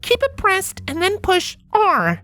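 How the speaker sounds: high, careful tech-support mare; high patient instructor voice. A narrator's reading. Voiced character giving instructions.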